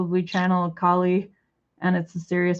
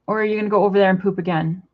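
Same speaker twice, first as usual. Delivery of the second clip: usual speaking voice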